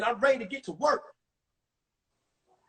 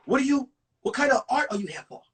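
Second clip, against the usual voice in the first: put-on fast eager kid; faster than usual. Lee's quick nervous question.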